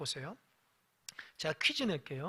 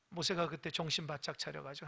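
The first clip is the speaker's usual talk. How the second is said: usual speaking voice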